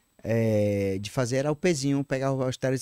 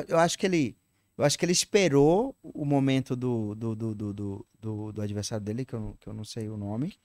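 ordinary talk, nothing marked

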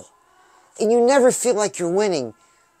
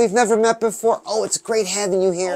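ordinary talk

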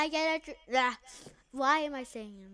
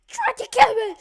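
outraged shout; 12 semitones higher, 17 dB louder, faster than usual. Real, not acted. Retelling game death.

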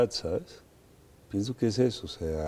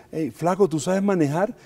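higher, louder, nervously asking; imitating a nervous actress checking on him; a mock nervous woman, pitched up; nervous question; 8 semitones higher, 6 dB louder than usual. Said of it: "ella es muy nerviosa y me dice".

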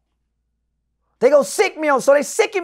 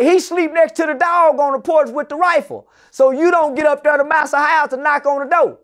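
normal talking voice

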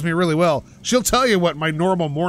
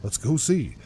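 much lower, unpushed deep rumble; super deep voice; 5 semitones lower, 5 dB quieter than usual. Shown as a demonstration. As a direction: let the voice drop to its natural low, deep and quiet. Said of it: "See, nobody wants to listen to that".

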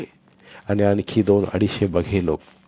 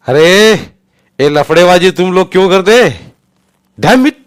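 high, loud furious yell; angry yell; 10 semitones higher, 13 dB louder than usual. Acted. Saldhana yelling at his policemen, furious yell. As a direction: bark at your constables in anger. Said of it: "पोलिसावर ओरडला".

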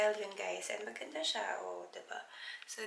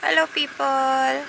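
loud, slow hello; cheery greeting; 5 semitones higher, 12 dB louder, slower than usual. Real, not acted. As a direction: greet the crowd big. Greeting viewers loudly.

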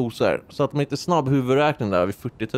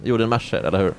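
ordinary delivery

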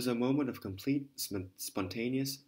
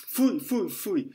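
disgusted cry; 7 semitones higher, 8 dB louder, slower than usual. Acted. Landlady disgusted exclamation.

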